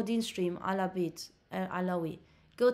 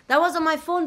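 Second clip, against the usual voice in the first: louder and higher, insisting it was her phone; emphatic protest; 6 semitones higher, 10 dB louder than usual. Real, not acted. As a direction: push back hard on the chat. Streamer protesting the chat's accusation.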